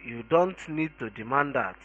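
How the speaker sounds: ordinary delivery, nothing marked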